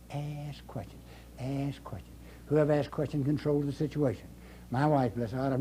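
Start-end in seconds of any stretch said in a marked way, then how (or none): 0.1-1.0 soft. Shown as a demonstration. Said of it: "repeated advice, then whispered". 1.4-2.1 a whispered reminder; whispered repeat. Shown as a demonstration. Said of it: "whispered repeat".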